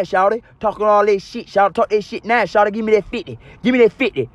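high, taunting stickup voice; mock robber threat. Robber pointing a gun, demanding the fifty in a high taunting voice.

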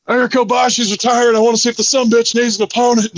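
hammed-up Stone Cold phone promo; Steve Austin impression. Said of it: "I did my Steve Austin impression".

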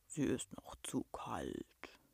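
very low, slow, hushed beast voice; rumbling monster. A narrator's reading. Monster explains why the child must stay, soft deep rumble.

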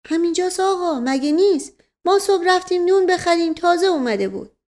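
high, loud-ish servant voice; high comic servant character; guileless servant. Acted. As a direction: answer the master in a high-pitched, earnest voice. Servant figure answers master.

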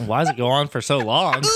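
usual speaking voice

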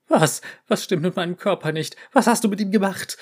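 frantic, breathless questioning; panicked. Acted. Panicked scientist questions cat.

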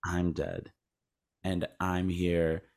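low, soft, measured acted quote; understated acceptance. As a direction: imitate quiet acceptance, without any freaking out. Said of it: "we didn't need a beat of Bruce Willis freaking".

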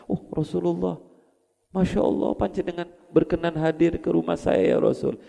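high-pitched, awed welcome of an honoured guest; kaget takjub. Retold or imitated. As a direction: act out a host's amazed greeting. Tuan rumah kaget didatangi Rasulullah. (suara tinggi, kaget dan takjub menyambut tamu agung)

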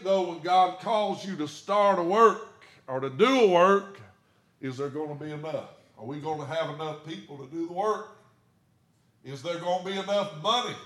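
high, loud preaching, slowing on the big question; preaching at full force. Acted. Pastor preaching to his congregation about money worries, full force. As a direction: rise up and preach it to the pews.

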